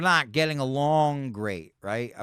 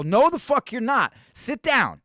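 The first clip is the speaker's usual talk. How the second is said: loud, high-pitched acted scolding; exasperated retold command; retold telling-off; 10 semitones higher, 8 dB louder, slower than usual. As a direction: order him back in his seat, raised voice. Retells scolding his travel companion.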